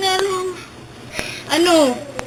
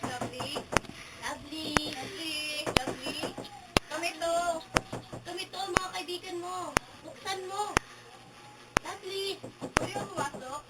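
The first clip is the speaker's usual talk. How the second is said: faint haunted voice; eerie murmur; 18 dB quieter than usual. Acted. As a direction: drift as if possessed. Possessed girl murmurs, answering friends.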